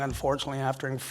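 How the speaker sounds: normal talking voice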